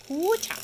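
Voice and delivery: high, loud little whoop; silly sound effect. Real, not acted. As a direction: punctuate the moment with a noise. I tend to make sound effects.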